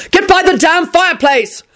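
high, exasperated yell; shouted order. Acted. Quentin to those under the couch, shouted order. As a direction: yell the command, pitched right up. Order barked at panicking family.

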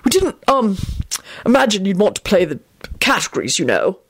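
halting, awkward explanation; awkward interruption. A narrator's reading. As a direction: fumble for a tactful excuse. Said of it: "broke in a bit too early".

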